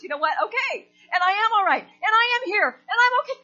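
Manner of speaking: imitating a tearful, triumphant outburst; high, loud, wailing affirmations; tearful declaration. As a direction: cry it out defiantly, building each phrase. Tears are streaming up.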